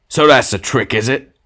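loud, high accusation with hidden triumph; bitter shout. A narrator's reading. Ghost outwardly bitter at being entreated, shouting. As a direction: pounce on the trick, loudly. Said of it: "shouted the ghost, outwardly bitter".

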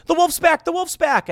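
high, loud mock cry of warning; hammed-up wolf warning; mock alarm. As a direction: yell it like the panicked boy. Boy crying wolf, retold.

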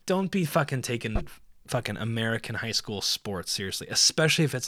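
usual speaking voice

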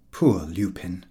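plain reading voice

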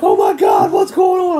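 loud, panicked cry; alarmed shout. Retold or imitated. Wife hearing the screaming from outside, loud alarmed cry. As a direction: shout it like you're hearing chaos.

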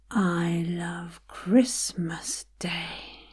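"Christmas" lifted out of a low, slow line; stress on CHRISTMAS. Shown as a demonstration. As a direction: lean on the first syllable of "Christmas". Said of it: "Find a different place to put the emphasis".

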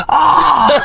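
very high mock scream of pain; high-pitched "ah!"; imitated scream. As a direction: shriek like someone just shot with a pellet. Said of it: "Gritando, gritando, gritando que flipas".

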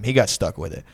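normal talking voice

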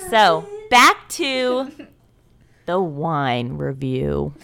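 low, very slow put-on host voice; the host slipping into a strange slow voice; odd announcer voice. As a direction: drag it out deep like a parody presenter. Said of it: "What was my voice just then?".

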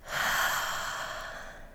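slow, breathy sigh of relief; celebratory sigh, drawn out; long slow sigh. Shown as a demonstration. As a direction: let out a long, slow exhale. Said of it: "long, slow sigh to celebrate".

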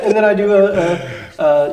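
usual speaking voice

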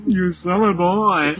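slow, high, laughing; laughing silly voice. Acted. Repeats the silly voice.

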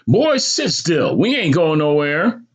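high-pitched, drawn-out order; scolding mother. Acted. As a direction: scold the fidgeting boy, stretching it out. Mother scolds fidgeting son.

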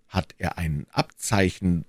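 ordinary delivery, nothing marked

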